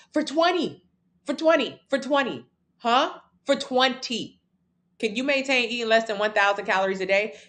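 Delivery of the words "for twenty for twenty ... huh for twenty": high, insistent repetition of "twenty"; pitched up and pressing, for twenty, for twenty; incredulous emphasis. Real, not acted. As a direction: drive the point home, disbelieving. Hammering home twenty years.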